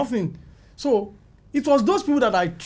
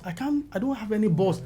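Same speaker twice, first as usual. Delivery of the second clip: ordinary talk, nothing marked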